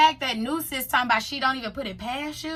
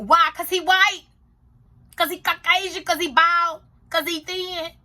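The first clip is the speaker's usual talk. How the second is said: incredulous; 5 semitones higher, 4 dB louder than usual. Real, not acted. Mocking rhetorical questions.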